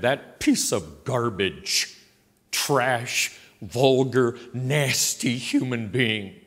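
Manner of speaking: each word spaced out and weighted, slowly; slow emphatic insult. Shown as a demonstration. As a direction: lay every insult down slowly and heavily. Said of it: "never heard someone say that".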